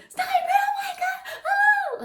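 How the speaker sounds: very high shrieking imitation of a panicking sister; imitated scream. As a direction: shriek it as if from far away, over-exaggerated. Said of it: "Like, she was screaming".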